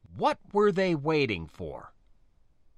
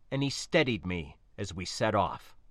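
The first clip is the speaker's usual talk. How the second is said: plain reading voice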